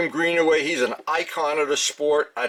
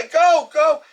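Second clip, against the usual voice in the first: high, louder urgent yell; retold yelling; 12 semitones higher, 5 dB louder than usual. Pilot shouting at the driver mid-flight, high retold yell. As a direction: yell to wave someone off, pitched way up. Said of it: "I'm yelling".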